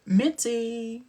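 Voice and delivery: high, prim scolding voice; scolding sister. Retold or imitated. Sister scolding Mitzi, high and prim. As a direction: chide her like a proper sister.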